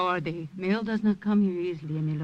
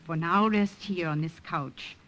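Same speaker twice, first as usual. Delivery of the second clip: ordinary delivery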